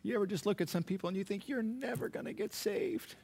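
retold tearful desperation, pitched high; choked up. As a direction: confide anguish to your wife, then let it rise. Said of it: "I was so choked up".

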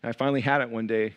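ordinary talk, nothing marked

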